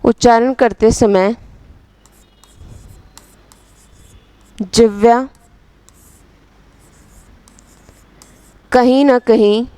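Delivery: slow dictation, phrase by phrase with long pauses; measured words spaced out for note-taking. Real, not acted. Teacher dictating a definition for students to write, slow dictation.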